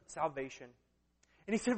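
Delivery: ordinary talk, nothing marked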